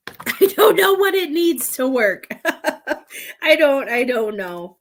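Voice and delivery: flustered, helpless laugh; real laughing. Real, not acted. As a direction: burst out laughing through the words.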